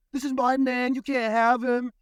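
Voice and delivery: lightly imitated woman fighting over a man; mock catfight. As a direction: stake the claim in a hint of a girl's voice. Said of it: "straight fighting".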